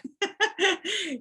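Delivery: a short high burst of laughter. Real, not acted. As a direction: break into a real laugh. Laughs after the quote.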